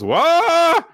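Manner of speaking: high, loud startled whoa; a leering yelp of surprise; mock gasp. Gakuro seeing the bikini fall, high loud whoa. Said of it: "and Gakuro goes".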